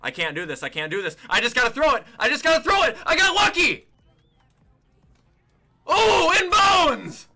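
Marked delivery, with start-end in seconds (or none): 1.3-3.0 louder, rising panic; desperate call to throw; tense, building shout; frantic urgency. Real, not acted. Panicking under pressure. 3.0-7.3 loud, high, drawn-out disbelief; stunned joyful yell; amazed shout. Real, not acted. Amazed at lucky play.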